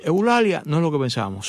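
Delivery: ordinary talk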